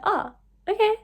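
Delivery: unsure, agreeing anyway; caught off guard. Retold or imitated. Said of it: "So I was like".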